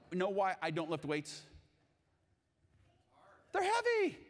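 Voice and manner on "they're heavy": laughing punchline. Real, not acted. Punchline about lifting weights.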